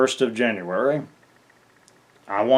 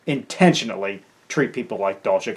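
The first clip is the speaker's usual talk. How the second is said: ordinary talk, nothing marked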